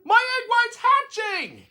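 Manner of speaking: very high, loud thrilled cry; excited shout. A narrator's reading. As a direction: shout it with amazement. Horton shouting at hatching.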